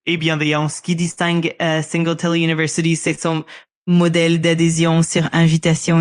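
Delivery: put-on Parisian French accent, fluent. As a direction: speak it like a native Parisian. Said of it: "use your Parisian accent".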